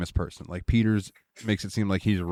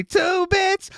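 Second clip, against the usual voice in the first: very high, cartoonish squeal; Roger bursting; 3 dB louder than usual. Roger dying to finish the knock, in a high cartoon squeal. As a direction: burst out the punchline like a toon who can't hold it in. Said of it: "he just wants to say".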